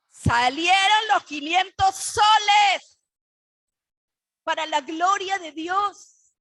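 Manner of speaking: loud, slow, exultant cry; triumphant shout. Real, not acted. Preacher to congregation, crying out the miracle in a slow triumphant shout. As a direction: shout the victory out slowly.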